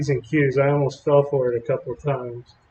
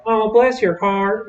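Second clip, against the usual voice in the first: higher, slow and syrupy sweet; sugary Southern lady; 7 semitones higher, slower than usual. Retold or imitated. North Carolina woman politely insulting him, mock sweet drawl. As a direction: lay the fake sweetness on thick. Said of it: "They're like".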